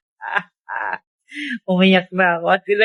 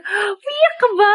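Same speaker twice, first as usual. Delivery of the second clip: mock worried question; 10 semitones higher than usual. Asking if she is crying.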